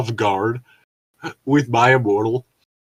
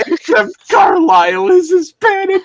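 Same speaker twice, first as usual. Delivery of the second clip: shout; 5 dB louder than usual. Real, not acted. Reading a joke out loud.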